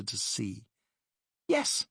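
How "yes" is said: high, sharp gasped yes. Acted. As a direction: catch your breath on the word. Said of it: "she gasped".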